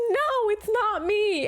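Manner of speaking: high, slow squeaky denial; friend denying. Retold or imitated. Best friend telling the boy it's not her, high and slow.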